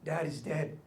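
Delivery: quiet, deadpan delivery of death news; blunt soft news. Retold or imitated. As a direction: say it softly and flatly. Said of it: "follow my uncle's strategy".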